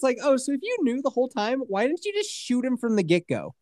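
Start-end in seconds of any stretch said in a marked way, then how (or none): none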